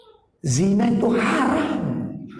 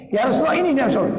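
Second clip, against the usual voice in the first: raised voice pointing someone out; mock eager call to the Prophet; a companion eagerly points out the young man; 5 semitones higher, faster than usual.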